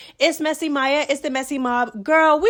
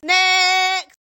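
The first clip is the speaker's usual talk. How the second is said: loud, higher Next; brisk dismissal; 5 semitones higher, 6 dB louder than usual. Real, not acted. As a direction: snap to the next topic. Dismissing the topic.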